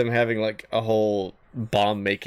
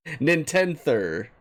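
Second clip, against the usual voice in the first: ordinary talk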